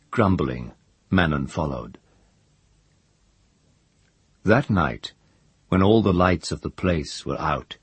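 ordinary delivery

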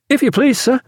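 high, eager youngster's voice; youthful joy. A narrator's reading. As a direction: burst in brimming with boyish delight. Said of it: "alive with youthful joy".

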